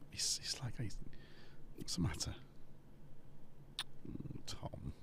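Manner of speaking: hushed whisper so the cat isn't disturbed; whisper, don't wake him; soft whisper beside a resting cat. Real, not acted. Whispering over the sleeping cat.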